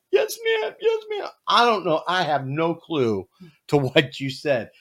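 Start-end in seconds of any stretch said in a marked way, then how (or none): 0.1-1.3 put-on very high, squeaky child voice; mimicking a frightened child's quick compliance; imitated obedient little boy. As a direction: pipe up like a scolded kid. Scolded boy answers his mother.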